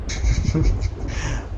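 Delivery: breathy smug villain chuckle; a short gloating laugh. Acted. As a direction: laugh it off, pleased with yourself. Villain laughs at being called egomaniac.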